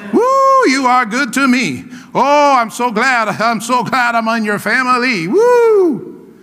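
exuberant praise. Acted. Preacher acting out praising God in the car, exuberant. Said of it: "we're like".

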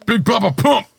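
high, loud, punchy name call; mock announcer. Riffing on Big Papa from the menu, mock announcing. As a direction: belt out the nickname playfully.

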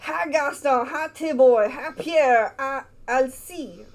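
put-on calling names in a Cajun accent. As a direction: Cajun storyteller voice. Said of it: "again, this is French".

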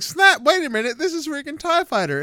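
suddenly recognize it; surprised. Retold or imitated. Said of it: "And then I was like".

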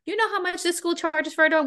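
indignant rant. Retold or imitated. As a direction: scoff at the threat in disbelief. Retold defiant rant at roommate.

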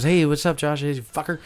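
Paige. Retold or imitated. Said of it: "that's a perfect Paige".

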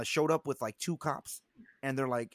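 usual speaking voice

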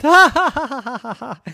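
high, loud acted cackle; mocking laughter. A narrator's reading. All the cars laugh at Yugo, mocking. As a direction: burst out in hammed-up ha-ha-has. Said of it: "all the cars in the jar laughed".

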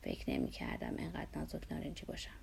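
very low, creaky, soft admission; soft, suffering low murmur; pained. A narrator's reading. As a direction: add it painfully, voice sunk low. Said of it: "دردمندانه اضافه کرد".

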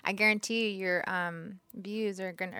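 ordinary talk, nothing marked

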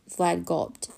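ordinary delivery

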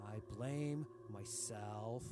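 low, soft and slow; quiet. Acted. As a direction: sink into a grim admission. Tyrant admits blame.

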